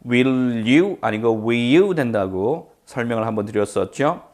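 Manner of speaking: normal talking voice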